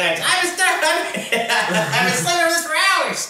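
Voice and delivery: Tyler impression. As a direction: pitch way up, laughing through it. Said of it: "It's like Tyler thinking".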